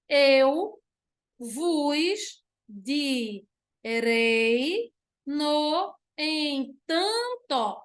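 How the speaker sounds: slow, syllable-by-syllable verse reading; leitura silabada. Shown as a demonstration. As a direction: stretch each syllable out for counting. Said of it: "vamos ver quantas sílabas". (leitura pausada, sílaba por sílaba)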